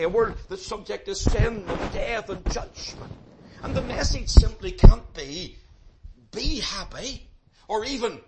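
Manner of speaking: loud, insistent pulpit delivery; forceful preaching. Real, not acted. Preacher warning the congregation of a fallen world, forceful preaching. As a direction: drive each phrase home with mounting force.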